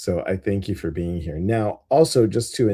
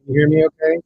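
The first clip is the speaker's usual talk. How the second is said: louder, higher sound check; raised voice to distant listeners; calling out; 6 semitones higher, 8 dB louder, much faster than usual. Real, not acted. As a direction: call out to check you can be heard. Checking if the stream hears her.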